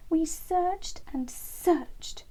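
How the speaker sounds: high, slow weary mother bird; high slow mum wren. A narrator's reading. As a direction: recall the long anxious search. Mum wren describing search.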